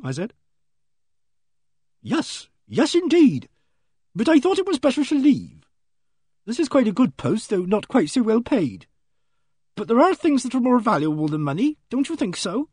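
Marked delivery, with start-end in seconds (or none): 2.0-12.7 fussy genteel lady clerk; prim spinster voice. Acted. Miss Ginch speaks.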